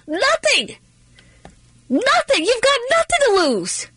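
high, emphatic; fired-up emphatic nothing. Real, not acted. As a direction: hammer the answer home. Emphatic answer to own question.

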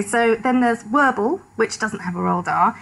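ordinary talk

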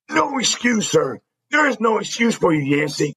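high-pitched mocking angry yell; angry dressing down. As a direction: throw the words back, furious. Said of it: "he's just in my face".

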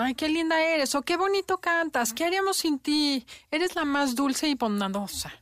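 high, sugary gushing voice; gushing praise. Retold or imitated. Adults praising a sweet child, high and gushing.

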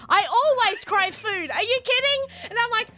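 high, loud mock panic; freaking out. Retelling her panic at pregnancy symptoms, high-pitched freak-out. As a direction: wail in exaggerated disbelief. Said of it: "And I'm freaking out".

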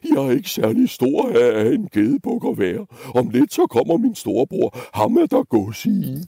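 high, wobbling goat voice; pleading middle goat. Acted. Middle goat offering his big brother instead, high wobbly pleading. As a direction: bargain anxiously in a bleaty voice. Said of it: "tryllede den mellemste bukke Bruse".

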